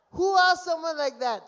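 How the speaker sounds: incredulous outburst. Retold or imitated. Incredulous at the curt text.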